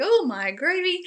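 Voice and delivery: high, loud swoop of exclamation; playful retold shock; mock dismay. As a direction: exclaim it in exaggerated disbelief.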